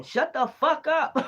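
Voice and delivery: high, slow, menacing growl of the deity; hostile spirit voice. Retold or imitated. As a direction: voice the angry spirit stretching each word. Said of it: "Look, stomping".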